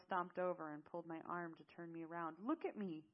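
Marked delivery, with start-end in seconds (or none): 2.4-3.0 higher, louder command; stern order. Acted. Zale turning her round to face him, ordering. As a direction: order it firmly. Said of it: "Zale ordered".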